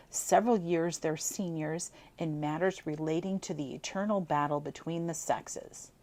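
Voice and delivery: plain reading voice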